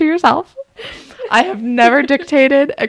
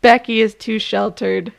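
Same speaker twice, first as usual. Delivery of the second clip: normal talking voice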